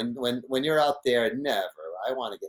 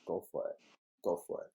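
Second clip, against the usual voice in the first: much softer, lower, resigned; giving in quietly; 5 semitones lower, 11 dB quieter, faster than usual. Retold or imitated. Telling Brock to take the wave, soft resigned voice. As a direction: let it go under your breath. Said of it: "I just went".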